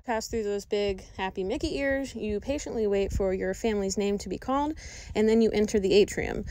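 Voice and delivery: normal talking voice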